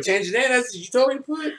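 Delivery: high, mock-outraged question; mock disbelief. Real, not acted. Teasing a friend about changing his name, mock disbelief. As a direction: pretend to be shocked at the request.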